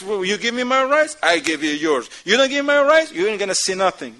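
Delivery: hammed-up spouse laying down terms; mock demanding. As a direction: push it out like an ultimatum. Acted tit-for-tat spouse demanding rights.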